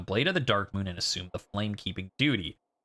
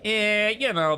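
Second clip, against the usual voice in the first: much higher, louder careless "eh, you know"; dismissive shrug; 11 semitones higher, 4 dB louder, slower than usual. Retold or imitated. Firekeeper imagined shrugging off the god's death, careless and higher. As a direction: shrug it off like it's nothing. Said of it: "she's just like".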